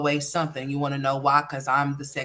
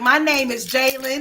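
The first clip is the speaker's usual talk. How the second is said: put-on higher, louder little-boy voice; imitating young son; 7 semitones higher, 4 dB louder than usual. Mom mimics her son saying his name, higher child voice. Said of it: "He'd be like".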